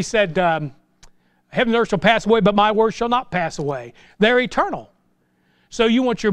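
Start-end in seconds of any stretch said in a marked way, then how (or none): none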